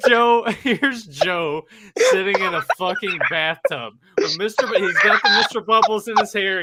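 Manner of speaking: laughing through the words; laughing while talking. Real, not acted. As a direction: crack up while telling it. Laughing while describing Joe.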